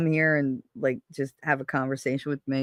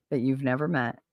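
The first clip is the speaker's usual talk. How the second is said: ordinary delivery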